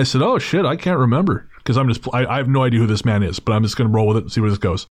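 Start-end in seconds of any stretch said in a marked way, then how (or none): 0.2-1.5 higher, put-on apologetic bluff; feigned recognition. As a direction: play along as if trying to remember. Playing along with stranger.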